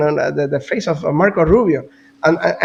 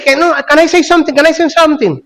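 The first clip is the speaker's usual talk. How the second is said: high, fast and pushy, cutting into the talk; an eager interruption, voiced high and quick; mock Zelensky butting in; 9 semitones higher, 3 dB louder, faster than usual. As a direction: barge in, begging to be heard.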